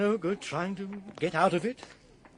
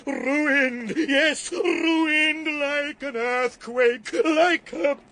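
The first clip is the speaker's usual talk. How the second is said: slow, louder, higher anguished cry; bitter lament; 8 semitones higher, 6 dB louder, much slower than usual. Acted. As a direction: drag out ruined like a man undone. Laments losing his job.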